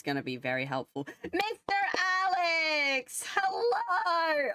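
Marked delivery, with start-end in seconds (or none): 1.2-4.5 high squeaky voice, very slow; squeaky high helium greeting. Real, not acted. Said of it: "Oh my god, my voice".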